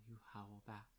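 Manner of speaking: ordinary talk, nothing marked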